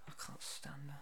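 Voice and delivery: breathy unvoiced whispered aside. Real, not acted. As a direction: hush it as a secret aside. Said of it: "whispered aside".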